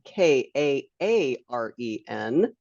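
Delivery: slow, letter-by-letter spelling. Shown as a demonstration. Host spelling guest's unusual name for attendees, spelled out slowly.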